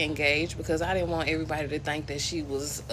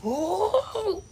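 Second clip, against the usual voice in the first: mock cousins calling out; 12 semitones higher, 6 dB louder than usual. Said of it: "my cousins be in there like".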